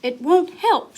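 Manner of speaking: high, loud mock wail of the princess; dramatic storybook wail. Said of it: "she wailed".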